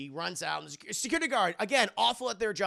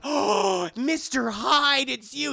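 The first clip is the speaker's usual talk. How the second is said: high, loud, slow melodrama; dramatic gasp; 8 semitones higher, 10 dB louder, slower than usual. Acted. Anna recognising the villain, high dramatic. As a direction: gasp out his name like a soap heroine.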